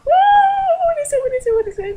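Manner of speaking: high-pitched squeal of alarm; panicked reaction. Retold or imitated. Retelling how feelers popped out at her, panicked. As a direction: re-enact a startled, repeated panic.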